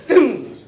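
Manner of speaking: loud, sudden booming thwack; high, explosive "boom"; imitated impact. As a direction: punch out the sound of a blow landing. Imitates the cane stroke landing.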